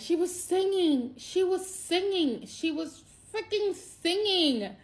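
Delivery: high, slow, emphatic wonder; stunned that her niece's song calmed her; awestruck. Real, not acted. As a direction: lean into each word with amazement. Emphatic awe at niece singing.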